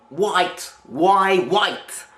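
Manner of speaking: put-on a pushy old Eastern European neighbour; Eastern European accent. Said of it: "this Eastern European voice".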